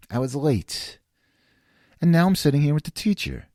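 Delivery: ordinary delivery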